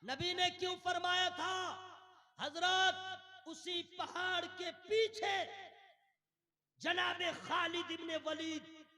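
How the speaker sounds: high, loud ringing proclamation; full-force preaching. Real, not acted. Preacher revealing who waited behind the hill, proclaiming. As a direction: hold the ends of phrases high and loud.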